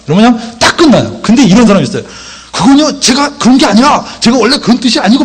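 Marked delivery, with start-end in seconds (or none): 2.5-5.2 high-pitched mock excuse; defensive protest. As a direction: protest innocence in a raised pitch. Acts out a defensive excuse-maker. (높은 목소리로 변명하는 시늉)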